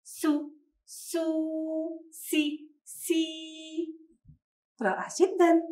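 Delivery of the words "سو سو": سو drawn out very slowly and softly; slow syllables. Shown as a demonstration. Teacher modelling سو for pupils writing, very slow and soft. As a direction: stretch سو softly, twice. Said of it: "قوموا بكتابة حرف السين".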